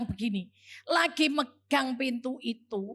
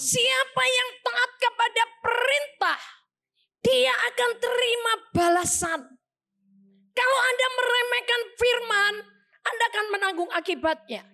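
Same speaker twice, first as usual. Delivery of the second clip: high, ringing exhortation; pressing, high-pitched warning to the congregation; emphatic preaching; 9 semitones higher, 3 dB louder than usual. Real, not acted. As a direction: drive the verse home at a high pitch. Preacher drives home the verse just read.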